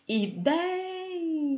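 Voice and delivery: Ideia said slowly and softly, counting i-dei-a; calling the word. Shown as a demonstration. Teacher calling out ideia to count syllables. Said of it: "você tem que chamar a palavra".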